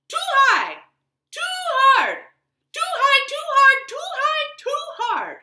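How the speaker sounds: very high, loud squeaky complaint; little creature voice. Acted. The hudgeon complains about its bed, very high and loud. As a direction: squeak it like a tiny fussy creature. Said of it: "heard a little voice say".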